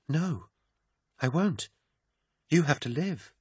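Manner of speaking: high, soft, desperate plea; tearful protest. A narrator's reading. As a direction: refuse through tears. Desperate denial.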